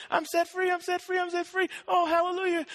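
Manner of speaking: high, elated cry of freedom; acted jubilant outburst; rejoicing. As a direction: celebrate in a high, ringing repeat. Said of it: "Instead of rejoicing, hallelujah, kicking his feet up, running outside saying".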